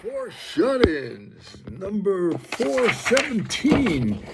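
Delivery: high, slow announcer title read; announcer intro. Acted. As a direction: announce the show title grandly.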